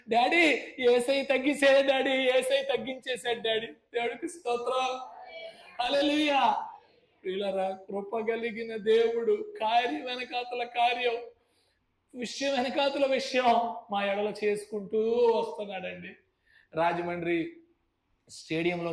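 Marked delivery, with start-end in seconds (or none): 0.1-3.7 put-on loud, high, happy child's cry; excited little boy. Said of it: "వాడు అంటాడు కదా, మూడేళ్ళ పిల్లాడు". 3.7-16.1 louder, higher preacher's praise; preaching praise. Acted.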